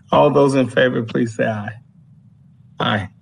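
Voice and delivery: ordinary delivery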